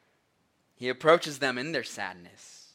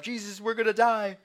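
high, frightened plea for rescue; panicked cry; 9 semitones higher, 4 dB louder than usual. Retold or imitated. Disciples waking Jesus in the storm, panicked high cry. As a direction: cry out as if the boat is sinking.

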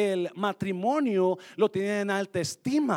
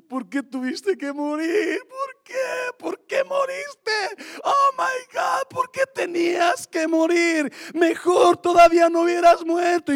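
crying, much higher and louder, with a sob; wailing grief; 11 semitones higher, 8 dB louder than usual. Retold or imitated. Joke about a man crying at a tomb, in a hammed-up wailing voice. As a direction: wail at the grave in mock despair.

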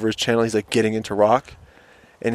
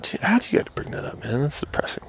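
mock hushed complaint; 4 semitones higher, 6 dB quieter than usual. Mock gloom over plane crashes.

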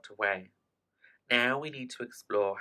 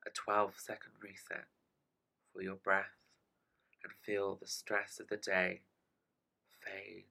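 slow, calm meditation guide; guided breathing. Acted. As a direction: talk them through each breath gently. Leads a breathing exercise.

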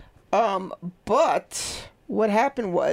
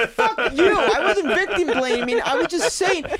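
laughing; 8 semitones higher, 5 dB louder than usual. Real, not acted. Protests accusation through laughter.

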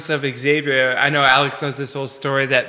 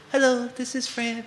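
higher, slower voicemail greeting; aunt's voicemail voice; 8 semitones higher, 7 dB quieter than usual. Retold or imitated. Imitating his aunt's voicemail, a higher, slower greeting. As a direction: put on a prim recorded-greeting voice. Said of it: "her voice is entirely different... on the phone".

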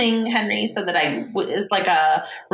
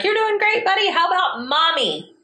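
put-on much higher sing-song praise to a toddler; coaxing mom voice; 10 semitones higher than usual. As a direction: cheer the baby on in a high coaxing voice. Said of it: "And I'm like".

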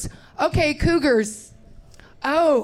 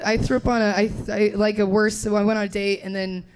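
ordinary delivery

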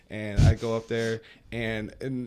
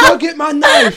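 very high, loud comic holler; hammed-up brawler's yell; imitated yell; 17 dB louder than usual. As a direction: push the mock threat into a squeal of a shout. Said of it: "one guy's yelling out".

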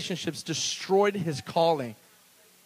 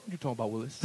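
much lower, soft mock Willis line; mock catchphrase; 13 semitones lower, 15 dB quieter, much faster than usual. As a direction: drop way down for the joke. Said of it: "just go".

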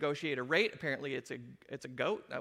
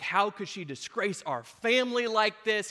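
higher, mock self-righteous outrage; fired up; 4 semitones higher, 4 dB louder than usual. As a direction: play an indignant patriarch, pitched up. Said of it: "And he gets fired up".

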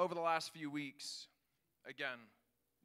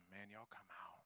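whisper; 6 semitones lower, 15 dB quieter than usual. Real, not acted.

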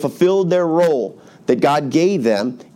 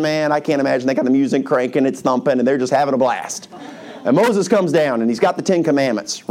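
ordinary talk

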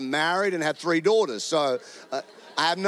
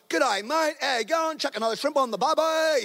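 high, hammed-up Australian accent; broad mock Aussie accent, pitched well up; 7 semitones higher than usual. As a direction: imitate an outsider overdoing an Australian accent. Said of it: "do an Australian accent".

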